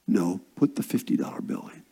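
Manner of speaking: low, soft, quick divine prompting; quiet inner voice. Retold or imitated. Spirit prompting preacher during a service, quiet inner voice. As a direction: murmur it low like a nudge from within. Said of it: "like the Spirit of God says".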